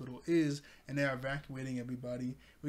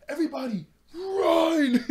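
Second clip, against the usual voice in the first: full-volume, high drawn-out yell; hammed-up alarm, very loud and stretched out; shouted warning; 13 dB louder, slower than usual. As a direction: act out screaming a warning to a crowd. Acting a character's alarm warning.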